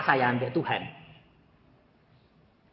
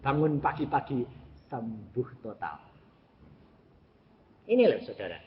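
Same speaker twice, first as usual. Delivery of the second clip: usual speaking voice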